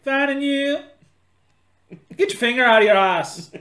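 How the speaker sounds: loud hammy Canadian voice; goofy hockey-guy Canadian; Canadian accent. Pretend. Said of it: "my Canadian accent".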